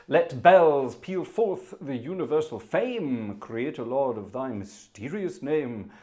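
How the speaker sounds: monumental voice. Shown as a demonstration. Organist reading the hymn words to viewers, slow and monumental. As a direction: proclaim it like it fills a cathedral. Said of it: "put on your big sort of mon-, monumental voice".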